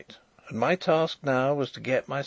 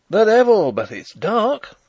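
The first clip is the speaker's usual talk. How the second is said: high, loud, ringing exclamation; loud oath flung into the dark; ringing cry; 10 semitones higher, 8 dB louder than usual. A narrator's reading. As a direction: cry it out boldly, pitched well up. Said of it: "cried a ringing voice".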